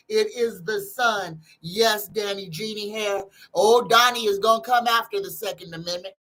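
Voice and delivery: usual speaking voice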